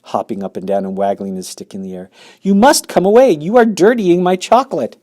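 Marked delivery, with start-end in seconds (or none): 2.4-5.0 high, loud, fussed pleading; hop-about alarm in the voice; the factory owner protesting to the boy; crying out. Acted. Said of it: "cried Mister Wonka".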